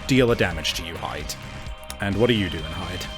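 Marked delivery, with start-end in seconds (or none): none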